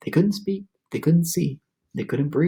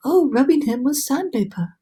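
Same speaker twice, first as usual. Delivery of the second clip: ladybug voice; 9 semitones higher than usual. A narrator's reading. Ladybug suggests a remedy.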